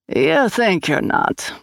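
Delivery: slow Swedish lilt, knowing; Scandinavian sing-song. A narrator's reading. Swede answering Grace's refusal, slow Scandinavian sing-song. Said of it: "up-and-down sing-song of Scandinavia".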